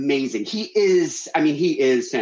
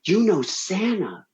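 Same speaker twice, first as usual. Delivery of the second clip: slow, higher-pitched amazement at full voice; amazed kids; 5 semitones higher, slower than usual. Retold or imitated. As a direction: imitate kids marvelling, drawing out each word. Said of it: "all these kids turn to me and goes".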